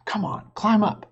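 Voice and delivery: put-on high, eager little-girl voice; urging girl. Sister urges her brother to climb, eager. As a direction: hurry him up the ladder.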